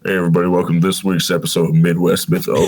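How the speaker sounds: very low announcer voice; sultry showman voice. Shown as a demonstration. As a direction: drop deep into a sultry podcast intro. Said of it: "your showman voice when you do it".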